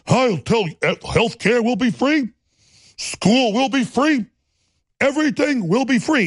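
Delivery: hammed-up politician promising everything, slow and high; mock campaign promise. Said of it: "He does this fake talk like this".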